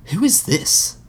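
high, wary voice of the Mouth; a suspicious aristocrat eyeing a stranger. Acted. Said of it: "the mouth asked".

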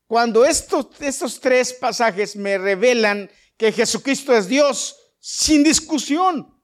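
loud, high, fervent pulpit preaching; preaching at full force. Acted. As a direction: preach it out to the whole church. (predicación a todo pulmón, con fervor)

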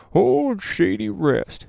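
put-on high, slow, savoring the old name; old-timey drawn-out name. Shown as a demonstration. Vlogger savoring a funny campsite name, drawn out high and slow. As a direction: stretch it out like an old prospector. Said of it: "It sounds like Wilford Brimley".

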